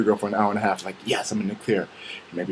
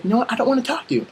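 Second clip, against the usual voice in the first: mock outburst, pitched way up; high-pitched refusal; 3 dB louder, faster than usual. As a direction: push it high for a comic refusal. Acted refusal to talk to girlfriend.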